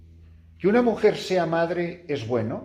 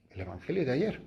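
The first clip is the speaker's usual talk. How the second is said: ordinary talk, nothing marked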